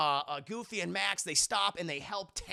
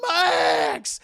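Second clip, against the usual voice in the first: high, loud cry of a name; alarmed shout; 10 semitones higher, 13 dB louder than usual. Retold or imitated. Goofy calling to his crashed son, alarmed shout. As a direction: yell it out in alarm.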